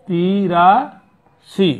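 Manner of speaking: তিরাশি stretched very slowly; drawn-out model word. Shown as a demonstration. Teacher to pupils, modelling তিরাশি slowly. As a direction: draw out তিরাশি for the class to copy. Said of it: "teacher models number".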